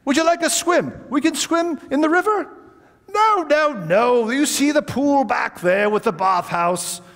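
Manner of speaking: put-on genial old gentleman. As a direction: offer the swim like a genial old host. Old host offers a swim.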